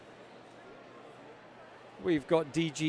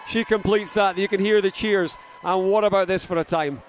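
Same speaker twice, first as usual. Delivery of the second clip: lively play-by-play, pushing louder; live race commentary; 4 semitones higher, 7 dB louder than usual. Acted. Commentator calling the race to the crowd, lively play-by-play. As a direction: call the ninja race as it happens.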